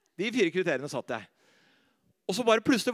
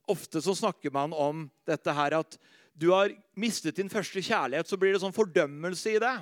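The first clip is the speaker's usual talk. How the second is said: ordinary delivery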